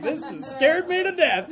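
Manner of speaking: put-on high, small, laughing child's voice; scared child voice. Five-year-old daughter watching her fainted father, high and lightly comic. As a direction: voice a frightened little girl, half joking.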